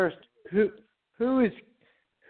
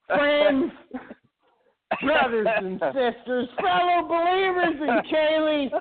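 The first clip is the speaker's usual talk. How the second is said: cult leader; 8 semitones higher than usual. Acted. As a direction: preach to the believers. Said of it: "you sound like a, like an witch cult leader".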